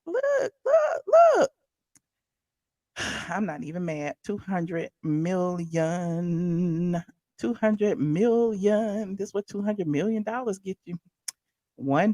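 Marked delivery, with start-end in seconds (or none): 0.1-1.5 very high shouted "look, look"; excited squeal. Real, not acted. Pointing excitedly at photos. 4.2-9.2 awed slow repeat. Real, not acted. Awed at price.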